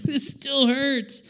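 high, slow mock whimper; whiny complaint. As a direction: drag it out in a pitiful high whine. Young self complains of pain.

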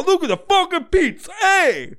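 high, loud cartoon Guido; mock Guido accent. Mocking Guidos showing off pizza, high Guido accent. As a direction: crow it like a New York caricature. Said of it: "It's always like this".

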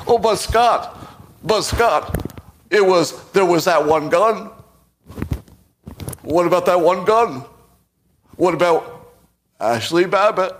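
high, loud mocking whine; mock critics as a whiny Trump; Trump impression. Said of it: "I'm gonna do a Trump impression".

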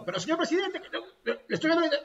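protesta aguda de burla, con risa asomando; imitando a Urresti. Retold or imitated. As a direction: insiste ante el presidente con voz aguda. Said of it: "y de nuevo al rato". (parodia de congresista terco, muy aguda)

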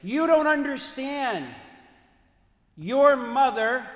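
loud, high, tearful shout; slow, loud, breaking cry of grief; anguished yell. Retold or imitated. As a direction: yell it through tears at your son. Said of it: "and he yelled".

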